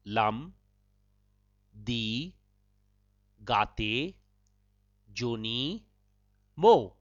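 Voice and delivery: "Lam... di... gate... joni... mo", a long pause after each word; broken up. Shown as a demonstration. Host breaks up the Dzongkha phrase for learners, word by word with pauses. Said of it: "I shall break it up for you".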